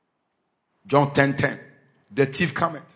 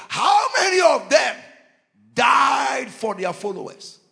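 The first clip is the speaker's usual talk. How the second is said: challenging shout; 7 semitones higher, slower than usual. Real, not acted. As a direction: demand an answer from the crowd. Challenging rhetorical question.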